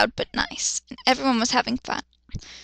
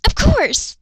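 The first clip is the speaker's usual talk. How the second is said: squealing; 10 semitones higher, 5 dB louder than usual. Acted. Quoted squeal.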